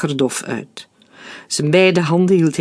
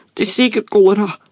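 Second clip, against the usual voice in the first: high, fast cry with a breaking voice; panicked outburst, voice cracking; 5 semitones higher, 3 dB louder, faster than usual. A narrator's reading. Frightened mother crying out to the doctor, voice breaking. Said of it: "riep Maria met overslaande stem".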